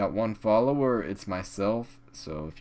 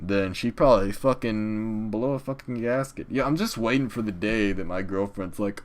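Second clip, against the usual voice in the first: ordinary talk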